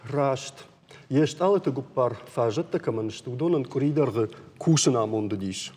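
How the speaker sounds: usual speaking voice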